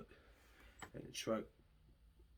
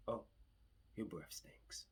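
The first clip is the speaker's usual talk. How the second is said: calm insult; 8 dB quieter, slower than usual. Shown as a demonstration. To the cop, calmly saying his breath stinks. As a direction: insult him without raising your voice. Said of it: "it'll come out very calmly".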